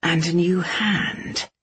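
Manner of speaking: slow words through gritted teeth. Acted. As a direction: force it out through clenched teeth. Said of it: "Kai asked through gritted teeth".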